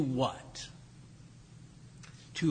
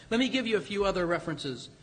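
ordinary talk, nothing marked

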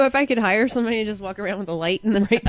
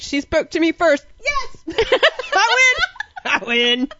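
much higher, giddy victory squeal; excited triumph; 9 semitones higher, 4 dB louder than usual. Retold or imitated. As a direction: celebrate as if winning a prize. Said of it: "I'm like".

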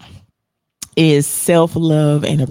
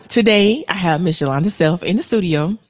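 ordinary talk

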